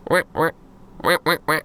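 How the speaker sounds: short, high-pitched quacks in small bursts; earnest wordless duck talk; imitated duck quacks. Quackington looks into Damon's eyes with understanding, quacking. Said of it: "looks in your eyes with understanding and goes".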